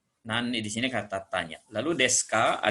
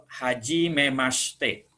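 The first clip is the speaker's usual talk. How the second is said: slow demo phrase; 3 semitones higher, much slower than usual. Shown as a demonstration. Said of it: "ini kenalkan".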